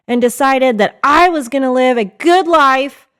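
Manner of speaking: ordinary delivery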